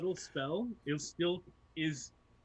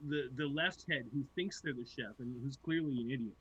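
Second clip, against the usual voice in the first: ordinary talk, nothing marked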